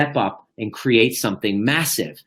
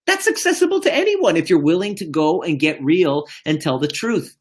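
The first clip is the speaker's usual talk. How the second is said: ordinary delivery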